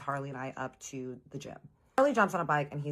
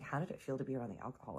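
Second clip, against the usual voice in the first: fast, softer echo of the question; baffled repeat; 2 semitones lower, 8 dB quieter, faster than usual. Retold or imitated. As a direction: repeat it to yourself in confusion. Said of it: "I'm like".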